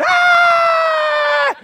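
long, piercing high scream; sustained hammed-up shriek. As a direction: scream at full volume, reenacting coaster terror. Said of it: "I'm screaming".

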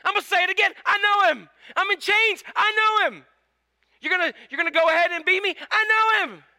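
high, loud joyful cry; excited Paul. Acted. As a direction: proclaim it defiantly with joy. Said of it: "But he said".

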